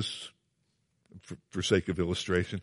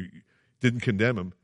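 normal talking voice

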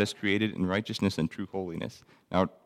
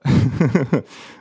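loud burst of chuckling; amused belly chuckle; hearty chuckle; 4 semitones higher, 8 dB louder than usual. Real, not acted. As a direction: chuckle out loud at your own point. Amused at his own remark.

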